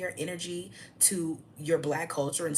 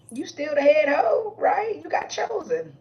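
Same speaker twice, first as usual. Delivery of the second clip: much higher and louder, slow taunt; mocking sing-song; 11 semitones higher, 10 dB louder than usual. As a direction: taunt her in a sarcastic high voice. Mocking a married woman.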